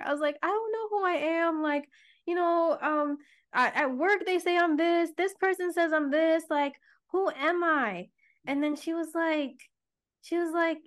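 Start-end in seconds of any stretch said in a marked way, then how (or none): 0.4-8.1 high, pleading and lost; reliving the confused cry on the phone; retold distress. Daughter on the phone to mom, lost and tearful.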